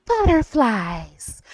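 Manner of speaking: slow, drawn-out soft imitation; imitated delicate poem-reading voice. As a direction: float the word out gently and let it trail. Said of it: "her tone was just".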